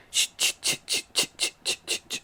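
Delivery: rhythmic "ch, ch" chain noise; voiceless, steady jingling sound effect; imitated jingling chains. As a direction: mimic change jingling with each running step. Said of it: "all you hear".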